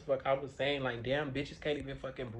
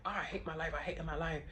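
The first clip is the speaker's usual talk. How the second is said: higher, whiny-style self-quote; lightly mimicking your own griping; mock complaining; 5 semitones higher than usual. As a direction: act out a mild "woe is me" complaint. Acting out her old complaint.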